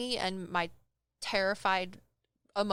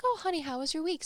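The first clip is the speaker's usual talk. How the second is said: lightly voiced mom, higher pitched; mom's greeting; 8 semitones higher, faster than usual. Mom asks her kid about the week, in a high greeting voice. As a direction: greet your child in a lifted mom voice.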